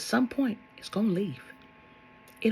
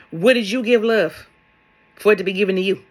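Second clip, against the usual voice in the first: ordinary talk, nothing marked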